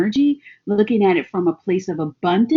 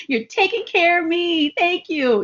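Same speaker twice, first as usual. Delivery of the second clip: gushing thanks; 8 semitones higher than usual. Retold or imitated.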